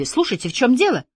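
high, anxious questioning; alarmed. A narrator's reading. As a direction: demand news with rising worry. Alarmed at news about her friend.

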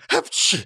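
breathy "apchi" sneeze; acted sneeze. A narrator's reading. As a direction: sneeze out the word without voice. Character's recurring sneeze.

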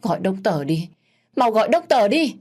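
high, loud panicked shout; shouting for help. A narrator's reading. Mother shouting for a doctor over her fainted son. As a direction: yell for the doctor. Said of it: "Bà Hai Tình hét lên".

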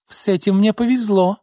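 high, scornful gloating; acted haughty retort; smirking. A narrator's reading. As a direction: say it with a mocking smirk. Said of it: "Изольда усмехнулась".

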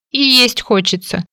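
slow, sniffly whine; whimpering. Acted. Heroine whimpers to the man that she is hungry. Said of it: "не прекращая всхлипывать".

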